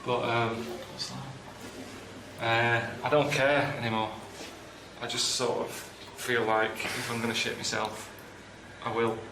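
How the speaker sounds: feeling sick. Real, not acted. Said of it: "I feel really sick".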